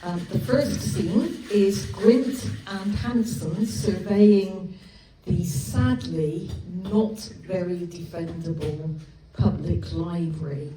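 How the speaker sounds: ordinary delivery, nothing marked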